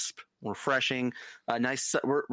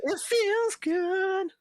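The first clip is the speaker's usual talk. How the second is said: high, very slow floaty voice; mock dreamy voice; much slower than usual. Friend mimicking the joy of mowing, high and slow. Friend voicing mower feeling.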